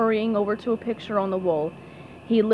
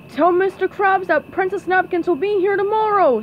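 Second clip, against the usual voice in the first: loud, raised driver's voice; truck driver; 8 semitones higher, 5 dB louder than usual. Acted. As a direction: call the message out over the engine. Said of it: "said the driver".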